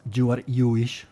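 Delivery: "You are you-ish" with j and y swapped round; mock Spanish English, sounds traded. Shown as a demonstration. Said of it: "completamente al revés".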